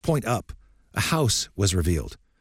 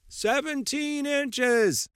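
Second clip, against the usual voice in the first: slow, high, drawn-out group answer; crowd in unison; much slower than usual. Acted.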